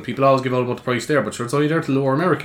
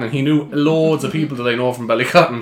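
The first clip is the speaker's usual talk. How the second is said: normal talking voice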